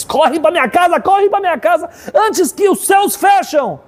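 very high, frantic urging; acted desperate summons; urgent plea. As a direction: cry it out high, drawing out the last words. Retold mother urges neighbour to run.

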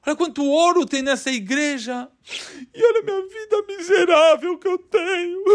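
a man crying alone in an empty church; crying lament. Retold or imitated. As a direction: complain between sobs about his misery. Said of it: "Enquanto eu chorava".

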